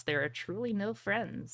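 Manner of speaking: usual speaking voice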